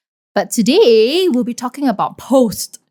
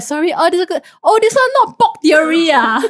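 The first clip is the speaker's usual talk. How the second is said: imitating flustered auntie; 9 semitones higher, 3 dB louder than usual. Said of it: "they were like".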